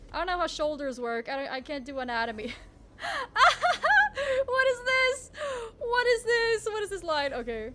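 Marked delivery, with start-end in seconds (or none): 3.0-4.4 high, loud burst of laughter; real laughing fit. Real, not acted. Streamer laughing at own bad drawing, real laughing fit. 4.4-7.3 high, loud cry through laughter, easing off; laughing dismay. Real, not acted. Streamer baffled by own drawn line, laughing dismay.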